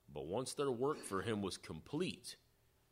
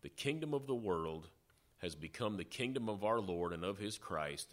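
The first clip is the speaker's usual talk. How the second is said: usual speaking voice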